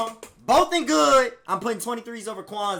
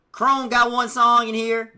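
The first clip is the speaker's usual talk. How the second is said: loud hype; 6 semitones higher, 10 dB louder, much slower than usual. Real, not acted. Hyping the ranking to chat.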